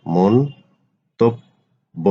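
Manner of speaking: মন্তব্য slowly as mon-tob-bo; slow model pronunciation. Shown as a demonstration. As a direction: stretch মন্তব্য for students. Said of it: "আলোচনা করব শব্দের উচ্চারণ". (মন্তব্য ধীরে উচ্চারণ)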